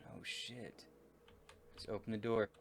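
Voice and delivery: normal talking voice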